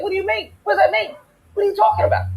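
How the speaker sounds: mock-baffled, high-pitched questioning; retold bafflement. Challenging the men who said go to Africa, high and baffled.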